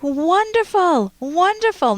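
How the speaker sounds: high, drawn-out delighted praise. Real, not acted. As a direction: sing out your approval, stretching it. Host praises caller's right answer.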